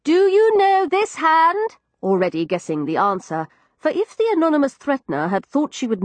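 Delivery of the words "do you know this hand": loud, slow and higher, shouted into a trumpet; shouted into ear trumpet. A narrator's reading. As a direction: bellow each word for a deaf old woman. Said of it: "Into it I shouted".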